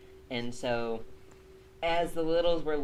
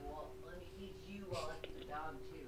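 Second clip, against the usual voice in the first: quiet; 3 semitones higher, 15 dB quieter than usual. Real, not acted. Talking quietly to pets.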